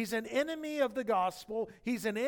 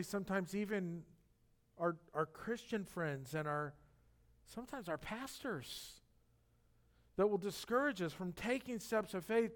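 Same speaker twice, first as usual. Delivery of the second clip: usual speaking voice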